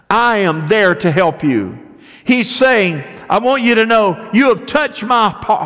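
high, loud, impassioned preaching; preaching at full force. Acted. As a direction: pour it out from the pulpit.

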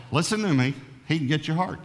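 ordinary delivery

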